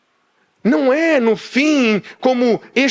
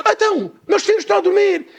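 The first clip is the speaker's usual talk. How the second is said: quick, high-pitched mock protest; hurried, high objection; acted protest; 11 semitones higher, faster than usual. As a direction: play the disturbed neighbour objecting. Woken neighbour protests at window.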